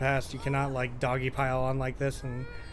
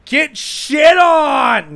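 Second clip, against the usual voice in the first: high, drawn-out, very loud jeer; taunting shout; 12 semitones higher, 17 dB louder, much slower than usual. Real, not acted. Player jeers at the opponent before kidding, a long shout. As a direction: yell a long gloating taunt.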